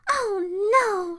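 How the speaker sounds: high little cry of dismay; cried dismay. A narrator's reading. Goldilocks lying on Papa Bear's bed, crying out. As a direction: cry it out high, mildly upset. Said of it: "she cried".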